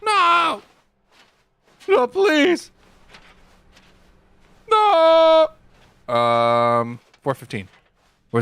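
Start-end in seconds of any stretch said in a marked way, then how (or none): 0.0-5.5 high, loud hammed-up cries of protest; mock pleading. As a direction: beg loudly in mock panic. Player acts pleading in game.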